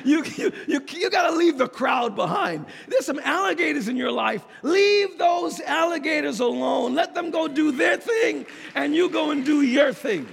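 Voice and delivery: emphatic, punched sermon climax; forceful preaching. Real, not acted. Preacher telling church to leave alligators alone, forceful preaching. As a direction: drive the moral home to the congregation. Preacher drives home the moral.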